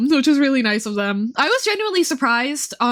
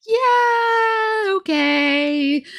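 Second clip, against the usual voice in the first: exasperated yeah okay; 8 semitones higher, much slower than usual. Retold or imitated. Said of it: "you're like".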